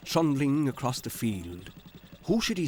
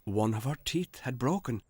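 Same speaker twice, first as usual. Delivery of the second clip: plain reading voice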